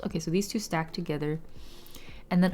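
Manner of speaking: normal talking voice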